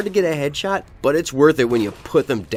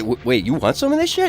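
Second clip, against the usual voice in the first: taken aback; 11 semitones higher than usual. Retold or imitated. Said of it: "You're like".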